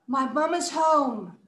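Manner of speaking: gasp, then slow, drawn-out surprise; surprised. Shown as a demonstration. Child caught as mom gets home, surprised. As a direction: gasp and stretch the words in shock. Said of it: "Let's sound surprised when we say".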